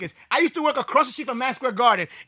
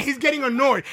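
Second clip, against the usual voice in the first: ordinary talk, nothing marked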